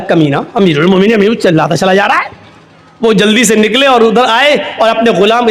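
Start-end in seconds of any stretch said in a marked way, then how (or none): 0.0-2.3 fast, irritated grumble; a touch of annoyance at the shouting slave; mild anger. Acted. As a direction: caliph annoyed while interrupted. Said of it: "उनको गुस्सा आया".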